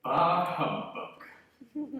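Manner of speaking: drawn-out, dismissive bah; scornful scoff. Acted. Scrooge scoffing at his nephew's Christmas greeting. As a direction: stretch it slowly and sneer.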